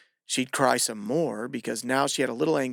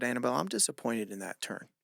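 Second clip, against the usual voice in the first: soft, cold coach disappointment; disappointed tone; 7 dB quieter than usual. Retold or imitated. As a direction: let her down quietly and coldly. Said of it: "with this tone and with this look on my face".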